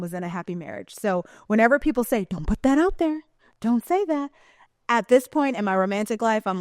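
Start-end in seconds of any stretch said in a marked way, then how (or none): 2.3-4.3 higher-pitched mock warning; mimicking worriers. People warning her about what she says, high mimicking. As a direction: imitate anxious advice, voice lifted.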